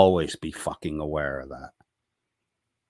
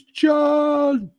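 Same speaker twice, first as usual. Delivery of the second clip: long, high-pitched loud shout of a name; high drawn-out call; mock holler; 7 dB louder than usual. Reads chat greeting as a holler.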